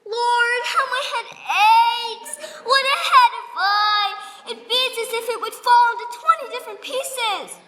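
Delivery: milk every ache for sympathy; a nurse whining to Juliet about her pains, hammed-up. Nurse moans about aches.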